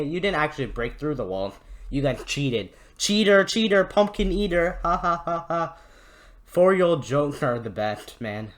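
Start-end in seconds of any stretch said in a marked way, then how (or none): 3.0-5.8 high, singsong playground taunt; childish taunt. Pretend. YouTuber mocks the Crusaders with a four-year-old's joke, childish taunt. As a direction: tease in a higher mock kiddie chant.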